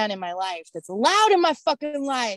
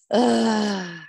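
disgusted groan. Real, not acted. Disgust at the preacher.

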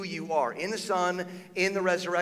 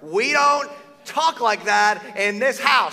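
scolding parent; 6 semitones higher, 9 dB louder, slower than usual. Retold or imitated. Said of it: "there's like".

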